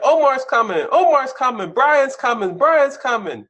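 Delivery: high, loud repeated alarm call; panicked lookout yelling he's coming; frightened warning cry. Retold or imitated. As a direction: shout the warning down the street. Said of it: "I want people to be like".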